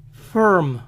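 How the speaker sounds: "Firm" as "frrm", no I sound; American "Firm", held on the R; English vowelless R. Shown as a demonstration. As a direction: say "Firm" as "ferm". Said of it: "No se pronuncia la vocal I".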